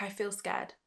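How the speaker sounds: small, soft confession; little voice. Retold or imitated. Inner voice admitting fear to her, small and soft. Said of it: "that little voice instantly stops and was like".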